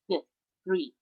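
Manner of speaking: th then three, tongue between the teeth; th sound demo. Shown as a demonstration. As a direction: hiss the th softly before three. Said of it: "gives me the sound th".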